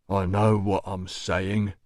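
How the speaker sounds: slow, deliberate doorman voice; unfazed doorman. A narrator's reading. Doorman defying the officer, slow and deliberate. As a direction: answer the warning slowly.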